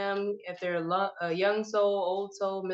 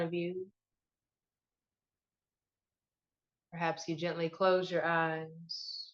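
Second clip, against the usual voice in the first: guided meditation; 9 semitones higher than usual. Acted. Host guiding listeners to find their breath, meditation voice. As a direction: lead listeners inward, leaving space after each prompt.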